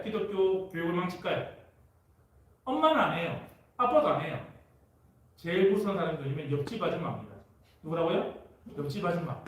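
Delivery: ordinary talk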